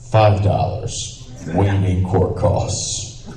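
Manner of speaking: slow, deliberate token sentence; long pause, then measured ruling; judge's sentence. Retold or imitated. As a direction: hand down the fine as a judge, drawn out. Judge gives token fine, deliberately.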